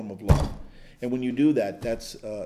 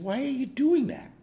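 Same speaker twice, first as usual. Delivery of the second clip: much higher, puzzled wife's question; wife asking why the scotch goes down the sink, much higher; baffled wife; 12 semitones higher than usual. Retold or imitated. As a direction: retell her bewilderment up high. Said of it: "She said".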